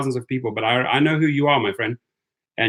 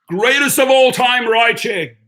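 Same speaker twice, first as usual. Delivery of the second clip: loud, high promo shout; hyped slogan; 11 semitones higher, 6 dB louder than usual. Pretend. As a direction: proclaim it like a big brand announcement. Announces a joke company slogan.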